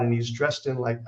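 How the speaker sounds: ordinary talk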